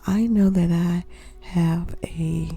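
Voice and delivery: normal talking voice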